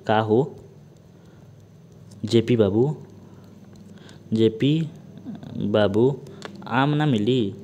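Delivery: word by word with long pauses; slow dictation. Shown as a demonstration. Teacher dictating the mnemonic to students, slow and spaced. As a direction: dictate each word slowly so students can write. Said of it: "इसको लिख लीजिए".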